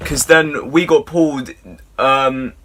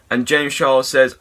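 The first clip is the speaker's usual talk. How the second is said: normal talking voice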